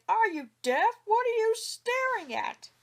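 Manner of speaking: high, loud angry shout. A narrator's reading. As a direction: bellow it right in his face.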